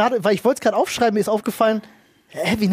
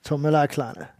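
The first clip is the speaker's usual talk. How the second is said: cool cop one-liner; 4 semitones lower, 6 dB quieter than usual. Pretend. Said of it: "Sprüche als Walker-Ordnungshüter".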